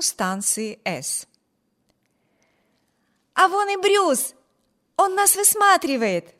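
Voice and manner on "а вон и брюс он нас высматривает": high, loud, eager cry of spotting someone; excited exclaim. A narrator's reading. Amy spotting her brother on the platform, excited cry. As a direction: call out happily, voice leaping up. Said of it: "воскликнула Эми".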